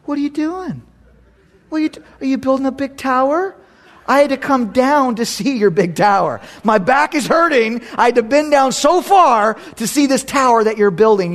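high mock-amazed sarcasm about the tiny tower; sarcastic God. God mocking the tower builders, high sarcastic voice. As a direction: tease them like a fake-impressed parent. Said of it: "God's being sarcastic".

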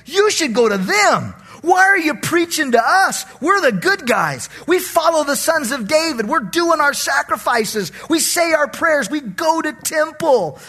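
high, loud, boastful churchgoer; self-righteous protest. Retold or imitated. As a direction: shout the smug list of good deeds. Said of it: "they said".

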